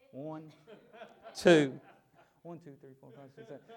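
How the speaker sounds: mock counting under the breath with laughter. Servant counting the army, amused and hushed. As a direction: count the enemy softly, chuckling. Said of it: "I laugh about this".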